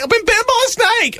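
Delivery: very high, hammed-up panicked wail; escalating panic. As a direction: push the mock alarm right to the top. Repeats the cry, escalating.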